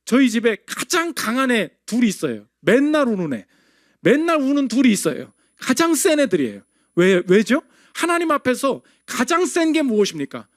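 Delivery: usual speaking voice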